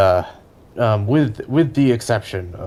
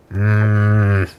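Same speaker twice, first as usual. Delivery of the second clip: muffled; 3 dB louder than usual. Acted. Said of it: "You hear back sort of muffled".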